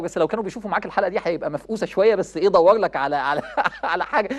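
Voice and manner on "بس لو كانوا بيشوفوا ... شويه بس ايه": ordinary talk, nothing marked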